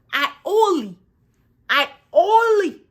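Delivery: high, emphatic protest; indignant insistence. Acted. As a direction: insist with mounting mock outrage. Indignant repeat about speaking English.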